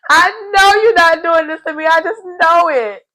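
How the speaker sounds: hammed-up high tearful wail; mock wailing disbelief. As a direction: cry it out in mock betrayal. Hammed-up repeat of Paul's dismay.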